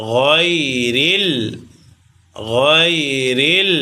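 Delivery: গয়রিল drawn out slowly with a quick গই; slow demo. Shown as a demonstration. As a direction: stretch গয়রিল, keep the গই short. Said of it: "গইন ইয়া জবর গই".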